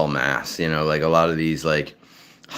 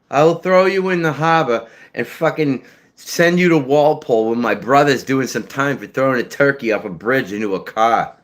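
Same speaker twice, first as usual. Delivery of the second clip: South Shore Boston accent; 8 semitones higher than usual. Shown as a demonstration. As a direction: threaten him like a hothead from the South Shore of Boston. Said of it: "See, I can do it".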